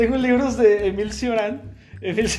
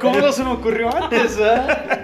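louder, higher mock realization through laughs; sarcastic laughing; 5 semitones higher, 6 dB louder than usual. Mocking the advice not to be sad, with sarcastic laughter. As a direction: pretend the answer was obvious, laughing.

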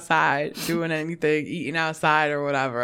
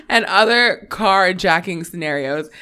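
normal talking voice

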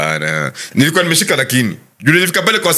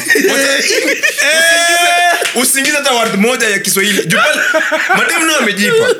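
hyped-up mock lecture; 12 semitones higher than usual. Mock lecture on English-only flirting.

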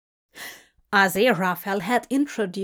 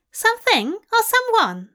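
high, teasing society woman; wicked flirty Tracy; 12 semitones higher, 3 dB louder than usual. A narrator's reading. Tracy teasing Aziraphale about Crowley, high and wicked. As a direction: tease it high with a wicked twist.

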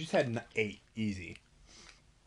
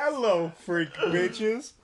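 laughing mock greeting; 7 semitones higher, 5 dB louder, much slower than usual. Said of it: "repeats the quote".

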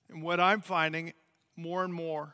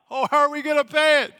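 loud, high-pitched cry of alarm; mock panic; 9 semitones higher, 14 dB louder, faster than usual. Missionaries facing a high electric bill, panicked outburst. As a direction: act out a sudden panic over money, high and loud.

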